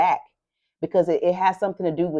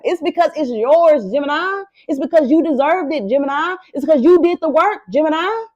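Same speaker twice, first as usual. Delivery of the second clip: excited; 7 semitones higher than usual. Real, not acted. Insisting Gemini deserved it.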